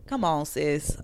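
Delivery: breathy, whispered disbelief; exasperated whisper. Real, not acted. Exasperated reaction to story.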